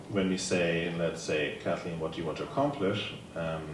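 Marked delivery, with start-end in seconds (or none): none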